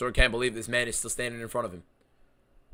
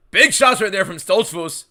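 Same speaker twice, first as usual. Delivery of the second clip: excited; 6 semitones higher, 7 dB louder than usual. Real, not acted. Live fight play-by-play.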